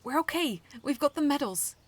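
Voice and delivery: high, loud and proud. A narrator's reading. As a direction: let the pride show. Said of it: "a little bit of pride showing through my voice".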